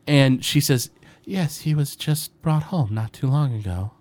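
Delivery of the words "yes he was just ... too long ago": a lightly voiced meek half-elf woman, a little higher; meek mother. The shy mother answering strangers at her door, meek and hesitant. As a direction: answer timidly, barely pushing the words out. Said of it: "a very meek-looking half-elf woman".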